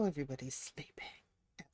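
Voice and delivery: hushed whisper. Real, not acted. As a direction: whisper so they stay asleep. Sims asleep, whispering.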